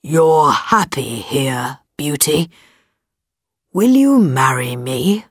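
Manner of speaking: deep beast voice. Acted. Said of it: "he said".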